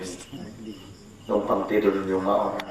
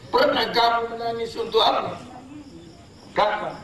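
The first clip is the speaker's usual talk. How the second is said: high pressing question; fiery preaching; 14 semitones higher than usual. Real, not acted. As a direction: demand an answer.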